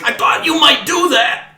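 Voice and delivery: high, loud goblin shout; goblin calling out. Acted. Second goblin up in the hills to his attackers, triumphant shout. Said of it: "you hear from up in the hills, he's like".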